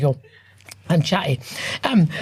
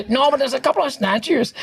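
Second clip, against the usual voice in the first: higher-pitched broad Glaswegian retort; 6 semitones higher than usual. Retold or imitated. Storyteller quotes a reply about Athens, broad Glaswegian. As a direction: imitate a broad Glasgow accent, pitched up. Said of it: "said in a broad glass".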